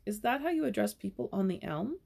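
ordinary delivery, nothing marked

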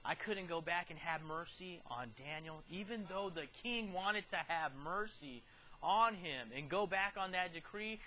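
ordinary delivery

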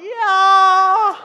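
put-on high, loud kids' cheer; mock class cheer. The children shout yes to the teacher, a high mock cheer. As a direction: yell it like a whole class.

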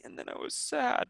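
demonstrated sadness, voice falling away; sad. Shown as a demonstration. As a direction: drop to a sad near-whisper. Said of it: "use your emotions".

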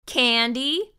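plain reading voice

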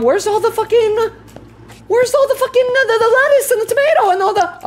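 high, flustered, stumbling over words; frazzled cook hunting for ingredients; frantic searching. Real, not acted. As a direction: search in a high-pitched panic.

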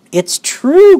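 high, loud exclamation; crying out. A narrator's reading. Old people assuring a doubting boy, loud high cry. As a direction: cry it out emphatically. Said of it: "cried all four of the old people at once".

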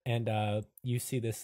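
ordinary talk

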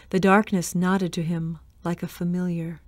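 ordinary delivery, nothing marked

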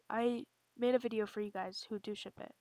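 ordinary talk

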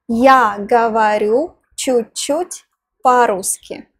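normal talking voice

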